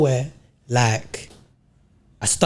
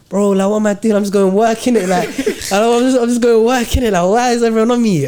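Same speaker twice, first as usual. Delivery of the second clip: exasperated disbelief; 7 semitones higher, 4 dB louder than usual. Retold or imitated. Defensive retold thoughts, laughing.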